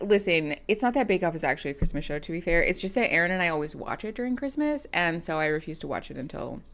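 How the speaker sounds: ordinary delivery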